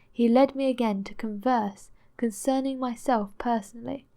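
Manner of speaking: ordinary delivery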